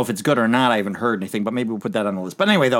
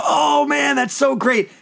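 high, hammed-up fawning praise; mock gushing crowd; 12 semitones higher, much slower than usual. Fans gushing over the apples line, high mock praise. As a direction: gush like an impressed crowd. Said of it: "everyone's gonna go".

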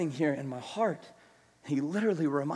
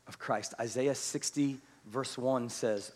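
ordinary talk, nothing marked

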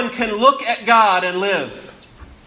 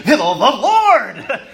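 hammed-up booming emphasis on LORD; mock overemphasis; 4 semitones higher, 4 dB louder, slower than usual. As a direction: LORD drawn out, high and loud. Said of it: "it's not just emphasizing it".

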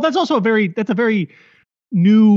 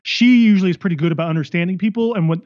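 ordinary talk, nothing marked

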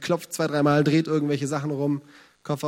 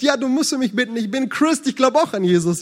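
delighted caller; 7 semitones higher, 5 dB louder than usual. Retold or imitated. Caller laughing, delighted on phone.

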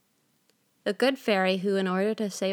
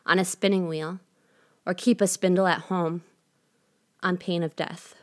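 ordinary delivery, nothing marked

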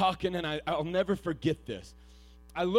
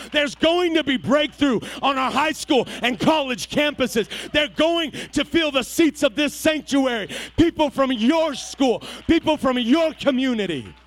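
louder and higher, pleading with the congregation; preaching at full force; 10 semitones higher, 15 dB louder than usual. Acted. Preacher reassuring students and young parents, full force. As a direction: pour it out over the pews.

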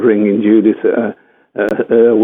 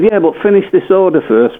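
high-pitched retort; 8 semitones higher than usual. Retold or imitated. Acted phone exchange with mother.

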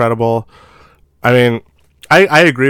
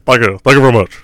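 lightly voiced [name] thank-you; [name] impression; 5 dB louder, faster than usual. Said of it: "the excessive [name] references".

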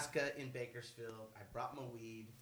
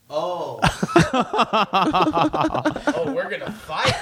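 mock dazed woman; 2 semitones higher, 11 dB louder, much slower than usual. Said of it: "she's just like".

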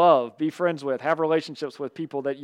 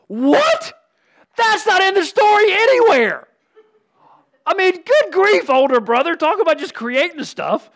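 high, loud, hammed-up disbelief; mock outrage; 12 semitones higher, 7 dB louder than usual. Preacher to congregation, mocking the older brother's invented charge, in hammed-up high disbelief. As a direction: pretend to be shocked at the older brother.

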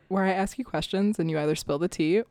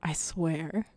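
whispering; 4 dB quieter than usual. Real, not acted. Whispered aside.

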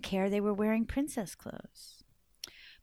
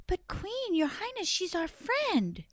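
pleading princesses; 8 semitones higher than usual. Acted.

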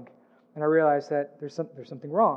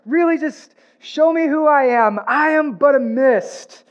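much higher and louder, gushing with fake delight; mock excited churchgoer; 9 semitones higher, 6 dB louder than usual. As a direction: ham up a listener thrilled by the verse. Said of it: "You're like".

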